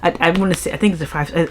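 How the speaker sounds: normal talking voice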